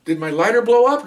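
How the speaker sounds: high, bewildered question; dazed disbelief. Retold or imitated. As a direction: ask it stunned and confused. Dazed lieutenant after explosion.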